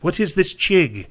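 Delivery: high, slow slurred Irish brogue; tipsy Irish question; drunk Irish accent. Retold or imitated. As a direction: chig said with an i, drunk and puzzled. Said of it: "He kept saying chig with his accent".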